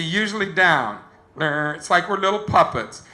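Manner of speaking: usual speaking voice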